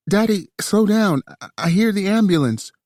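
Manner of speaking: high, loud alarmed voice; urgently calm down the caller. A narrator's reading. Alarmed woman on phone.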